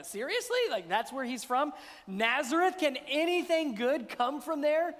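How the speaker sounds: "Nazareth?" rising high in disbelief; sceptical, mocking disbelief; incredulous scoff. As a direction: scoff as if nothing good comes from there. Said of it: "say it with the inflection like that".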